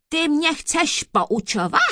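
loud, high indignant question; offended judge thundering; roaring. A narrator's reading. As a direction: roar it in outrage. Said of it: "burácel soudce".

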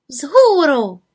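high, loud rallying cry; enterprising exclamation. A narrator's reading. Little witch rallies for an outing, exclaiming eagerly. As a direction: call it out, eager to set off. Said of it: "zvolala podnikavě".

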